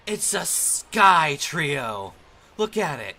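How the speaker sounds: very loud, high cry of amazement; hammed-up booming excitement; excited shout. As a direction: shout out the big discovery. Exclaims at a Pokémon in the sky.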